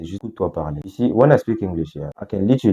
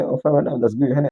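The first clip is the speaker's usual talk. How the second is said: high-pitched British approval; imitated British accent; 7 semitones higher than usual. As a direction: put on a chirpy British accent, voice lifted. Imitates the British man's approving reply.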